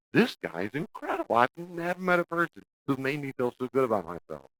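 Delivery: high, lightly voiced infatuated girl; a hint of a dazzled girlfriend; smitten girl. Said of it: "they're like".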